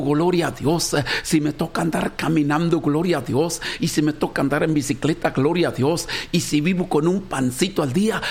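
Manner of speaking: ordinary talk, nothing marked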